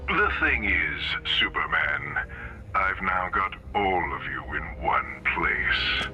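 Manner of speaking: low, measured villain menace; gloating threat. Acted. Villain threatens to dispose of heroes.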